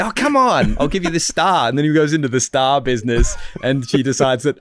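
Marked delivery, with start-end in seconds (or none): 0.0-1.7 put-on high, pleading dad coaxing his kid. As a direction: wheedle like a dad bribing a child.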